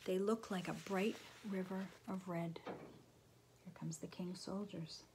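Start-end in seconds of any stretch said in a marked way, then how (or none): 2.6-5.0 slow, near-whispered announcement; hushed warning. A narrator's reading. Narrator warning that the king's soldiers are coming, hushed. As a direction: breathe it out slowly under your voice.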